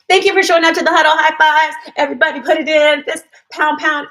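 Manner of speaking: rally the team like a coach; hyped huddle cheer. Real, not acted. Closing her live stream with a pumped team huddle cheer.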